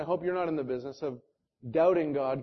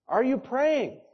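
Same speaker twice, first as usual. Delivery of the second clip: higher and more urgent on the repeat; pressing, higher repeat; 7 semitones higher, slower than usual. Shown as a demonstration. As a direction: push the question home.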